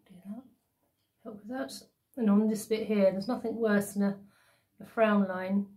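face covered while talking; through a sheet mask. Real, not acted. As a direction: mask limiting cheek movement. Seen 0: Wet sheet mask on face throughout, mouth opening left uncovered.

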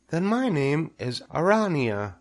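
high, small declaring voice; little spider. A narrator's reading. Second spider names herself, high little voice. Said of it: "said the spider".

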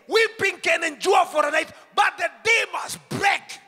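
shouted high sermon climax; fiery preaching. Acted. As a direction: preach it out at full force. Preacher building to full shout.

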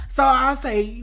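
ordinary talk, nothing marked

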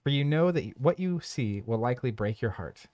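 measured storybook narration; narrator voice. Acted. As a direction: read it like a dark tale. Reads game scenario text.

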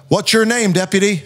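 high, barked officer question; playing the commanding officer; gruff officer. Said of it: "This guy's like".

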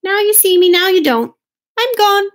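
high, loud mouse voice; showy high-pitched little mouse; mouse's exit. A narrator's reading. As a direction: announce the getaway in a high voice.

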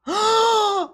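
very high-pitched yelp; real high cry of surprise; startled squeal. Real, not acted. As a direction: let out a high, sudden squeal. Startled by button effect.

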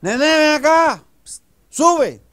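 high, loud, drawn-out summons; calling from upstairs. Acted. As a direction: call down from the seventh floor. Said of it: "una señora en un séptimo piso que lo llama". (llamado a gritos desde arriba)

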